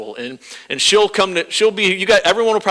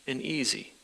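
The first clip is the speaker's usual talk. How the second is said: ordinary talk, nothing marked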